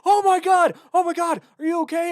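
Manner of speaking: hammed-up frantic father finding his son; Robin Williams panicking. Retelling the movie scene, frantic high father voice. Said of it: "He's like".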